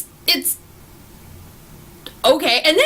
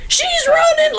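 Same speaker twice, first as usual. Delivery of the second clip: soldiers' shout; slower than usual. Retold or imitated. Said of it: "they're just like".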